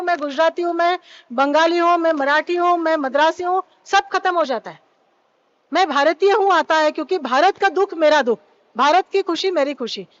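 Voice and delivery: ordinary talk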